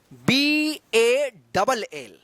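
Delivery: very slow letter by letter B A double L; stretched spelling, each letter apart. Shown as a demonstration. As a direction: spell it out slowly for the class. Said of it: "क्या पढ़ेंगे इसको".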